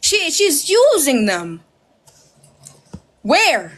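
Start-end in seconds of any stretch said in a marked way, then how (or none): 0.0-1.6 dragged-out, slow sarcastic gasp; slow, exaggerated mock shock; slow mock outrage. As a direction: stretch it out like a melodramatic hater. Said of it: "mocking the critics".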